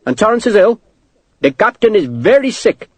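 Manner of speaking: put-on accented clerk, high-pitched and insistent; fussy clerk. Clerk fending off a questioner, officious.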